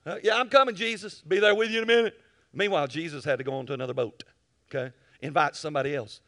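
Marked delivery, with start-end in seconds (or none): 0.2-2.2 fast, louder, higher brush-off; half-hearted promise. Pretend. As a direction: stall with a breezy excuse. Believer stalling Jesus.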